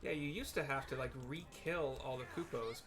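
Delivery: ordinary talk, nothing marked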